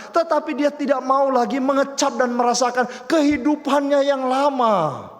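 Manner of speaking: loud, driving sermon delivery; full-force preaching. Acted. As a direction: preach it out to the congregation.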